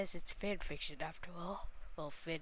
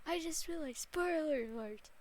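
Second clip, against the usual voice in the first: sudden spoiler alarm; 6 semitones higher, 7 dB louder than usual. Real, not acted. Gamer realizes a spoiler.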